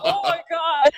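loud, high, laughing exclamation; cracking up. Real, not acted. Podcaster reliving getting caught by his wife, cracking up. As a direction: gasp it out through real laughter.

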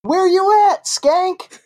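very high, loud yell; loud call from background. Acted. [name] yells at a friend in the background, loud and high. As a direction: shout across the room. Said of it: "[name], you hear".

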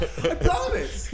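high giggly protest; squeaky promise. Acted. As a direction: swear it like a caught liar. Ancestor denying.

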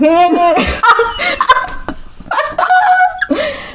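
high, long burst of laughter. Real, not acted. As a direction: crack up hard. Laughing at the scene.

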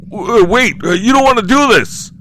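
high, loud terrified pleading; panicked begging. Acted. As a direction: beg for your life. Lindell begging the killer.

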